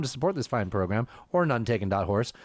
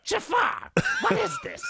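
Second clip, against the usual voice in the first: much higher, squawking cartoon parrot; Iago voice. Host showing his cohost he can do Iago, squawking much higher. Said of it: "I could do Gilbert for, like, an Iago".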